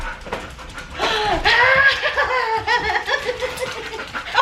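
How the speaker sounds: high squealing laughter; excited laughter. Real, not acted. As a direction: laugh with joy. Reunion with her dog.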